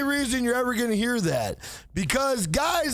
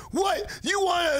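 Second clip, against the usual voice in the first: mock challenge; 5 semitones higher than usual. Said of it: "the other guy's like".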